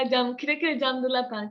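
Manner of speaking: normal talking voice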